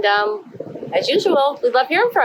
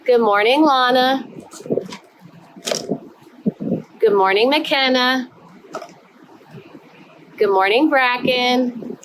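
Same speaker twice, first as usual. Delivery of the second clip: bright sing-song roll call; kindergarten teacher voice; 3 semitones higher, 5 dB quieter than usual. Acted. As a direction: greet each little one warmly. Greets each child by name.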